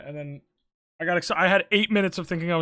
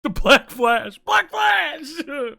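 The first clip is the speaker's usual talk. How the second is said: hyped callout; 7 semitones higher, 10 dB louder, much slower than usual. Acted.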